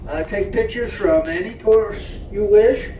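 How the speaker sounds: booming conductor's welcome announcement; train announcer. Acted. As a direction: welcome the passengers aboard, announcer style.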